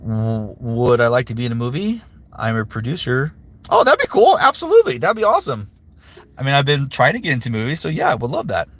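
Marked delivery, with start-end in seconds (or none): 3.7-5.7 very high, loud delighted squeal; excited. Real, not acted. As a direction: burst out with high glee. Thrilled reply to movie offer.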